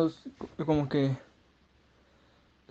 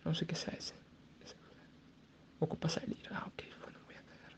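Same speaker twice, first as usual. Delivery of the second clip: hushed whisper for his stomach's voice; 2 semitones higher than usual. Pretend. His stomach complains to him, in a whisper. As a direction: whisper it like your gut talking. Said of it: "Mi panza dice".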